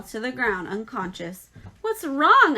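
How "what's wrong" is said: much higher and louder startled question; the announcer suddenly thrown by the fainting pig; alarmed announcer. A narrator's reading. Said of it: "asked the loudspeaker".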